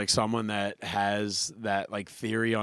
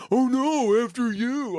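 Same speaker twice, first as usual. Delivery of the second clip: high, loud, exaggerated politeness; mock sarcastic courtesy; 12 semitones higher, 5 dB louder, slower than usual. Driver alone in the car after holding a door, mock sarcastic. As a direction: act out a heated, sarcastic rant in a high voice. Imagined rant over unthanked held door.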